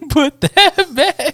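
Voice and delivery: high, very drawn-out mock repeat; laughing mimicry. Friend echoes the accidental Coke sip, laughing mockery. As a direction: stretch it out through a laugh.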